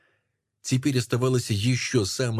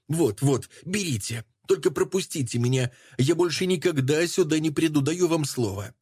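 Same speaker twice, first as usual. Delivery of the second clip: desperate pleading; 7 semitones higher than usual. Acted. Irena pleading in terror.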